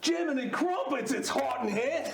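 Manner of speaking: comic Australian complaint; Aussie accent. Acted. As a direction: exclaim like a cartoon Aussie. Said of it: "Keep the accent if you like".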